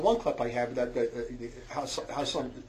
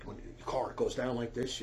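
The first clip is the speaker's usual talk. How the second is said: ordinary talk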